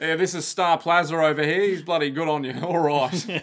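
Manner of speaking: mock Aussie introduction, laughing at the end; Australian accent. Australian Jojo introducing a mate, mock accent. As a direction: ham up the ocker mate. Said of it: "We did Australian Jojo".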